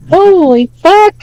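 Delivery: high, slow falsetto exclamation; guy in the video. Retold or imitated. Streamer repeating what that guy said, falsetto mimicry. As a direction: mimic the onlooker, squeaky and stretched out. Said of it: "exactly what that guy said".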